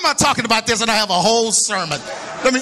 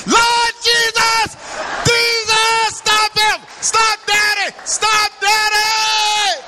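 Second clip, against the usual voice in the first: retold screaming; 11 semitones higher, much slower than usual. Son screaming at Daddy not to shoot the duck, panicked high yell. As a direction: holler like a scared kid begging. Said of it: "he was screaming".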